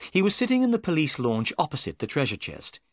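usual speaking voice

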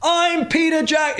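high, slow, hammed-up self-introduction; pompous mock director announcing himself; mock grand announcement. Mocking a vain director who shouts his own name.

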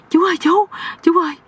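high, urgent acted call. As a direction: call for the uncle in a high, pleading pitch. Runs up calling to uncle. (gọi với, giọng cao)

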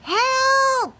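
high panicked yell. Acted. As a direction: cry for help from the water. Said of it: "yelled the spider".

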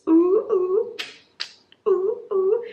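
high rising oohs; excited cooing. Real, not acted. Fan cooing high over a bodyguard romance. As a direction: coo with delight.

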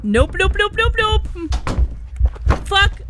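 loud, high string of nopes; panicked refusal. Real, not acted. Player running from the house, panicked refusal.

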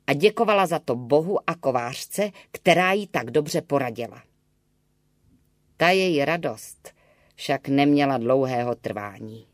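ordinary delivery, nothing marked

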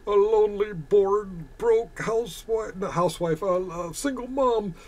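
high, hammed-up pitying whine; mock sob story. Streamer explaining why she got a tip, mock tearful.

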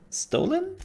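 high, leaping question; mock surprise. Real, not acted. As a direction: jump up high, feigning shock. Guessing the NPC's euphemism.

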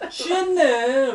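laughing. Real, not acted. Laughing at tipsy wife.

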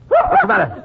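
sudden alarmed cry; startled. Acted. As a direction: jump at the sudden sneeze. Startled by Costello's sneeze.

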